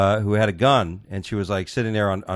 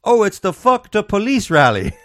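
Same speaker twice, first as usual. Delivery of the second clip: mock caller; 8 semitones higher, 4 dB louder than usual. Woman on 911 call.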